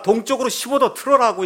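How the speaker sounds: calling the order across the fog; shouted order. Retold or imitated. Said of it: "이 배에서 지금 대고 외칩니다".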